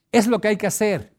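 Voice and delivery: ordinary talk, nothing marked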